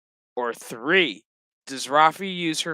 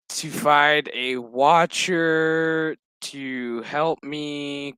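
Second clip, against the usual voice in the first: dragged-out retelling; 2 semitones lower, much slower than usual. Retold or imitated. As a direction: drag it out, then let it trail away. Retold speech dwindles into blahs.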